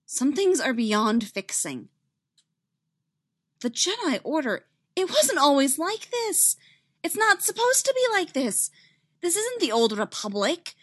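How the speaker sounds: earnest and emphatic; heated insistence. Acted. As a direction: argue the case with urgency. Heated argument in the story.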